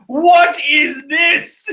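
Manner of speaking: high, loud, drawn-out disbelief; incredulous outburst. Real, not acted. Reader lost at the title page, shouting incredulously. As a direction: stretch the question into a baffled shout.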